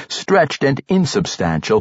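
ordinary delivery

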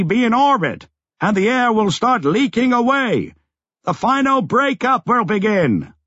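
calling out. A narrator's reading. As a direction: shout an explanation over the roar. Lobsang calls out over the quake.

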